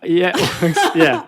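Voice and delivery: laughing along at a shared confession; real laughter. Real, not acted. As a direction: burst out laughing in agreement. Laughs at S1's admission of judging.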